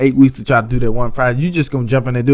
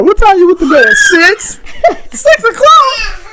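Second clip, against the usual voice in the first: high, loud laughing disbelief; shouting through laughter at six o'clock; 6 dB louder, much slower than usual. Real, not acted. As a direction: crack up while mocking the bedtime. Teasing wife about bedtime.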